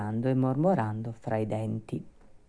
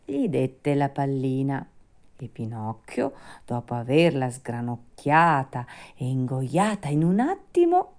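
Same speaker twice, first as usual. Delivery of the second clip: ordinary delivery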